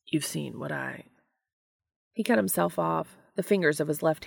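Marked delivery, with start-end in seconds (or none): 0.1-1.1 mumbled. A narrator's reading. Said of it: "Dean mumbled".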